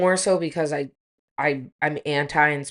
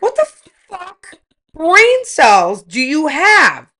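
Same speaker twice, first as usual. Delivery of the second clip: incredulous scorn; 9 semitones higher, 8 dB louder, much slower than usual. Real, not acted. Mocking incredulous outrage.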